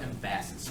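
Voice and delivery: "emphasis" as em-PHA-sis; "emphasis" with the weight on its second syllable; wrong-syllable stress. Shown as a demonstration. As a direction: jokingly stress the middle of "emphasis". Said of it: "I changed the emphasis on the different syllable".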